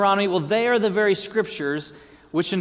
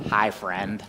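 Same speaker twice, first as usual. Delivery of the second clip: low, odd "Hi, friend" greeting; mock the strange way of greeting a friend; weird stiff greeting; 11 semitones lower, 4 dB quieter than usual. To an old friend Jim, a mock awkward greeting. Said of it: "walk up to Jim and go".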